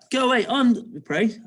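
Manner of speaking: high, loud piggy cry; piggy's reply. Acted. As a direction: read it back as the protesting pig. Reading back the pig's reply.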